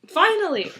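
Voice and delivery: loud, high burst of relief; exclaimed relief. Real, not acted. Reader reaches the last chapter at last, exclaimed relief. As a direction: cry it out, long overdue.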